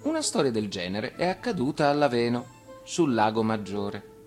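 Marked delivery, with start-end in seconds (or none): none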